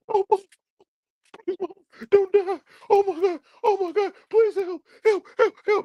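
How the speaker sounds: high, whiny mocking falsetto pleading; squealing high fake-crying plea; mock crying Kane. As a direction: ham up a sobbing mama's boy.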